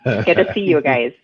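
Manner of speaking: put-on playful Minnesotan accent, slow; chirpy Minnesota nice greeting. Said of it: "That's my Minnesotan accent".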